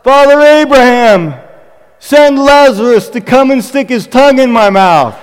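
high, loud preacher's plea; crying out. Retold or imitated. Pastor preaching the rich man's plea to Abraham, loud cry. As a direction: call out dramatically at full pulpit force. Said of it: "the rich man cries out".

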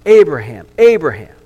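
God calling out to halt Abraham. Acted. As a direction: call Abraham to stop him. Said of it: "God speaks up".

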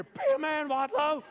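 loud, very high coach impression; high-pitched yelling coach. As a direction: holler high, like a coach in your face. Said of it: "He's like, he's like pushing my legs".

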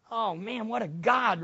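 normal talking voice

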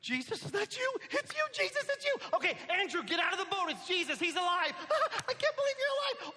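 high, laughing disbelief; overjoyed. Acted. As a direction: burst with joy at seeing him alive. Peter sees Jesus alive.

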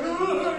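high-pitched mock wail; small, high cry of disappointment, imitated; mock upset cry. Two snubbed Star Trek fans, mock upset cry. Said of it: "two poor Star Trek guys were just like".

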